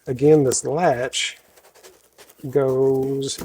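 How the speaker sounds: usual speaking voice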